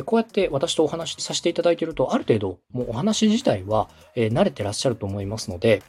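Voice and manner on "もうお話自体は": ordinary talk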